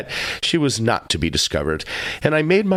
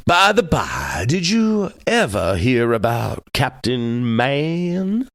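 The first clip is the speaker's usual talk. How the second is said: very slow, affected drawl; drawn-out syllables; slower than usual. Acted. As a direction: stretch every syllable out at leisure. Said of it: "peculiar way of drawing out his syllables".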